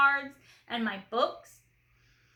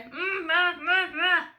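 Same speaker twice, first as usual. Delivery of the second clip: high, louder muffled hums through a gag; gagged mumble; 6 semitones higher, 5 dB louder, slower than usual. Acted. Little sister gagged with a sock, trying to talk, muffled mumbling. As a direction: mumble hard through a sock stuffed in the mouth. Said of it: "Don't speak with your mouth full".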